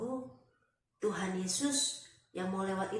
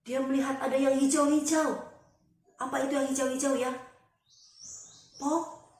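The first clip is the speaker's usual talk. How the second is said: excited discovery; 6 semitones higher, 6 dB louder than usual. A narrator's reading. Bartimius sees green things first time, excited wonder. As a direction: marvel at a first sight, puzzling it out. Newly sighted man discovers trees.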